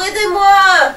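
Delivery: high, loud plea; desperate yell. Acted. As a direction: scream for help from the water. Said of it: "crie-t-il".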